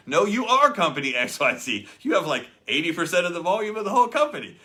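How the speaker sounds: loud, high, laughing disbelief; loud excited protest to the top earner. Retold or imitated. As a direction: blurt it out, laughing and amazed. Star earner says he's company XYZ.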